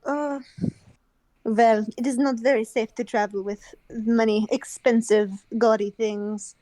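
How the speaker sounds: put-on measured, refined French accent. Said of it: "poor French accent".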